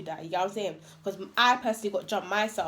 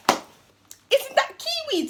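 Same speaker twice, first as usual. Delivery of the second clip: drawn-out high squeal; high-pitched mock surprise; much slower than usual. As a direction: squeal it slowly, laughter creeping in. Acted double-take question in story.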